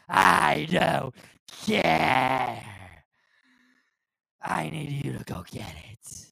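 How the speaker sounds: loud, drawn-out boss tirade, easing at the end; Vince McMahon. Acted. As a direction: roar the dismissal, then lay down the order. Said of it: "a nice little Vince McMahon impression".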